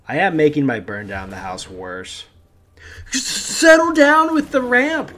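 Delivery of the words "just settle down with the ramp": very high, loud, drawn-out outburst; stretched high-pitched gamer shout; exasperated yell. Real, not acted. Exasperated at opponent's ramping in game.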